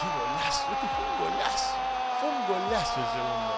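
high, thrilled shout about the goal; excited cheer. Real, not acted. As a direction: cheer it like a fan watching live. Streamer reacts to goal replay. (emocionado, gritando de alegría)

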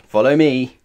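loud, curt order; grumpy command. A narrator's reading. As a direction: boss them onward. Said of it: "grumped Pa Utterly Otterly".

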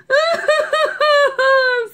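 high staccato storybook boo-hoo; hammed-up wailing sob; mock sobbing. A narrator's reading. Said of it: "sobbed the dogs".

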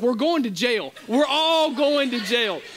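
scared to death. Retold or imitated. Brandon fearing jail over the quarter machine, terrified. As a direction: wail it like doom is certain. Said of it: "He is as serious as he can be".

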